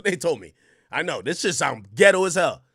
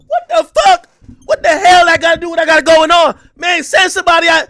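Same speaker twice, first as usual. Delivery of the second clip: high, loud exasperated yelling; furious shout; 11 semitones higher, 14 dB louder than usual. Retold or imitated. Streamer shouting at a call center agent, high, loud and furious. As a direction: blow up at the useless advice.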